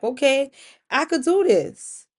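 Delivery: confident. Acted. Diviner after her first success, confident. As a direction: stretch it out with growing confidence.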